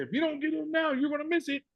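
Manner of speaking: high, hyped-up hard sell; mock high-pressure sales voice; pushy sales pitch. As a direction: pitch it like a pushy recruiter creating urgency. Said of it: "I don't feel it's okay to say".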